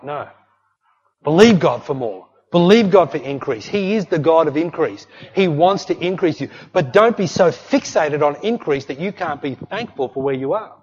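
normal talking voice

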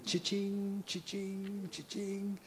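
very soft cha-ching, repeated evenly; hushed till sound in his head; imitated cash register. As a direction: ring up riches privately, barely voiced. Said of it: "ringing that up in his mind".

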